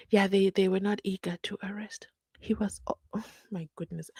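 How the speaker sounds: unvoiced, fading to near silence; stunned whisper. Real, not acted. Vlogger reacting to the prosecutor's words, stunned whisper. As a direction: whisper in disbelief. Incredulous at the claim.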